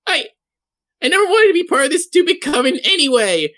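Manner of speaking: high, fast, petulant outburst; huffy hag Karen. Acted. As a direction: quit in a high-pitched melodramatic huff. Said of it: "but Karen is just like".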